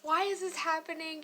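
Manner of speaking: high, loud upset wail of why; near tears. Retold or imitated. Upset vlogger near tears over the tax form, crying out. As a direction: cry out why this is happening, close to tears. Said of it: "I immediately wanted to cry".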